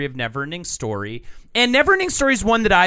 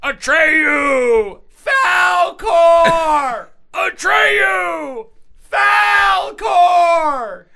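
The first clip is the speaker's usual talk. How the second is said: high, drawn-out screaming of names; over-the-top child hero wailing for his friends; hammed-up movie impression; 13 semitones higher, 5 dB louder, much slower than usual. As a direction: cry out as if to someone lost. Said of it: "this is my impression of the entire movie".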